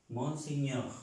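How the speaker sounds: ordinary talk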